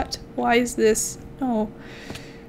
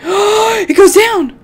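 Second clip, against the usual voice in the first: higher, louder, slow thrilled discovery; excited gasp; 9 semitones higher, 9 dB louder, much slower than usual. Real, not acted. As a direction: gasp and marvel. Gasping discovery of cave.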